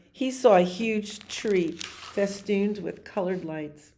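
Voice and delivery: usual speaking voice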